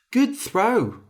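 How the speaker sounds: ordinary delivery, nothing marked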